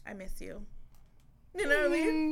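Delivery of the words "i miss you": much softer, lower, tender; soft miss you. Retold or imitated. Partner making up after a fight, soft and tender. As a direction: say it small and sweet. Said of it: "he'll be like".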